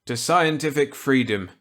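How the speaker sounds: put-on eager reply to an old colleague; Walker's voice. Walker accepting Millet's gambit eagerly.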